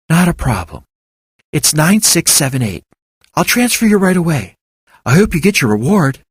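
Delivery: a receptionist happy to help; friendly receptionist. A narrator's reading. Elizabeth helps the caller.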